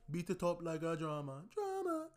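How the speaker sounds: imitating a Nigerian artist's accent, high pitch; rap-sung line in a light African accent. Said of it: "he does it in his African accent".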